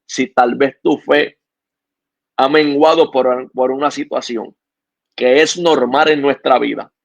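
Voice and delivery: earnest, steady exhortation to the listener; calm sermon appeal to a struggling listener; preaching appeal. Acted. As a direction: plead with the listener to keep the faith.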